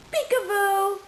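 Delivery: high, loud playful reveal; excited peekaboo. Pretend. Mother playing peekaboo with her baby, squealed reveal. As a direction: pop out with delight.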